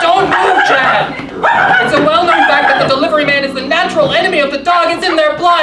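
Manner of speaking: loud, fast frantic warning; panicked shouting. Acted. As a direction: yell it in a panic. Dogs surround, frantic warning.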